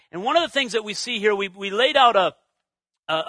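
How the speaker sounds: usual speaking voice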